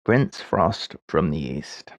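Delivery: low, slow, musing reread of the clue; thoughtful slow clue reading; slow pondering reread. Shown as a demonstration. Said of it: "rereads the clue slowly".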